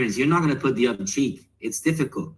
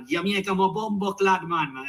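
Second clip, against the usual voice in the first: higher-pitched Jamaican patois accent; put-on Jamaican accent; 6 semitones higher than usual. Shown as a demonstration. Guest showing he can do different accents, Jamaican accent. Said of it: "I could do different accents too".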